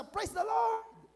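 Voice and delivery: very high, drawn-out mock cheer; acted congregant's shout. Believer answering the preacher's promise, high acted shout. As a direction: whoop it like an eager believer. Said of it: "malalakas ang boses kapag sumisigaw".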